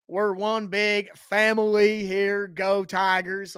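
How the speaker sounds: slow, hammed-up Southern twang; mock Southern twang. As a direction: drawl it like a coach faking a Southern accent. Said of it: "where the hell did that Southern twang come from".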